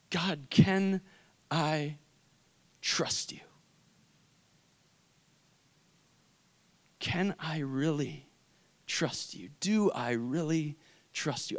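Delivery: very soft, halting, nearly whispered; hushed doubting prayer. Retold or imitated. Grieving son asking God about trust, hushed doubt. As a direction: ask it haltingly, with long pauses. Broken private prayer after mother's death.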